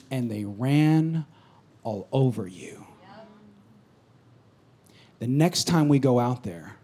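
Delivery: low, soft, grim coach; low grim rebuke. Retold or imitated. Coach quietly rebuking the team after a loss. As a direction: scold them quietly and heavily.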